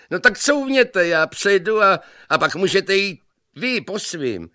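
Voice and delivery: very high, loud youthful shout; brash young man. A narrator's reading. Laughing young man tells his rival to back up, shouting. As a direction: holler it cheekily. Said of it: "se zasmál".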